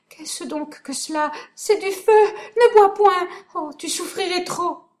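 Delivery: high, loud cries of pain; agonized pleading. Acted. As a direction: scream out the burning poison. Doña Sol in poison agony.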